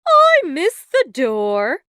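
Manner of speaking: breezy, slow and unbothered; airy. A narrator's reading. Fairy godmother shrugging off the chimney, airy and slow. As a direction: toss it off lightly. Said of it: "Felicity replied airily".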